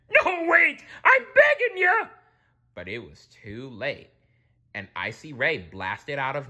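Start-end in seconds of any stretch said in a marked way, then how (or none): 0.1-2.1 very high loud pleading; begging. A narrator's reading. Krabs begging the king, high pleading. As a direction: beg for mercy. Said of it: "threw up his hands".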